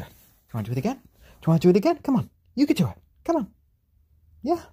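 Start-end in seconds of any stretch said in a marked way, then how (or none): 1.0-2.0 "Do you wanna do it again?" asked much higher and louder, thrilled; excited. Real, not acted. Owner urging chick to fly again, high and excited. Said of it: "I know you can hear the excitement in my voice". 2.0-4.6 excited. Real, not acted. Owner cheering chick to fly, high and excited. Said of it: "I know you can hear the excitement in my voice".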